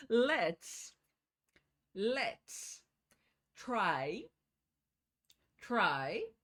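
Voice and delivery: each word said slowly, twice, with long pauses. Shown as a demonstration. Teacher slowing down so students can repeat each word. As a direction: break the phrase apart and repeat every word. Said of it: "Vamos devagar".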